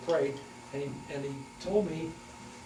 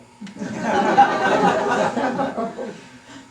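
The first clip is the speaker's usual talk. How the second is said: hearty laugh; 8 semitones higher, 11 dB louder than usual. Real, not acted. Laughs at the memory.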